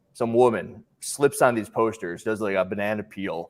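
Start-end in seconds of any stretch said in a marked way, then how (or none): none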